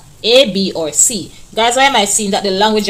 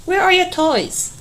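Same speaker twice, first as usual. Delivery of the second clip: much higher, questioning voice; high, curious question; asking tone; 9 semitones higher than usual. Shown as a demonstration. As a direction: ask it like a real question. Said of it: "A question".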